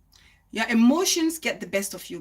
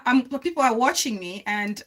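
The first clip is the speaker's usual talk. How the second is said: ordinary talk, nothing marked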